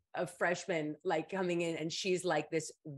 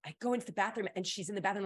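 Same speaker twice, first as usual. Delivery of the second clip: ordinary delivery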